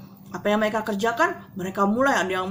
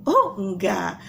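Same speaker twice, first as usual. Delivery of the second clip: ordinary talk, nothing marked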